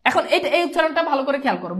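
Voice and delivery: usual speaking voice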